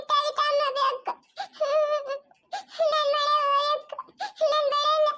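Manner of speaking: put-on a small girl begging to be taken to grandma; high, whimpering child voice; lost little girl.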